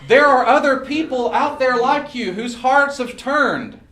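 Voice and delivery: high, loud rallying oratory; impassioned campaign-speech delivery; rousing stump speech. Real, not acted. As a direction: stretch the phrases out and rally the crowd. Rallies the crowd in stump speech.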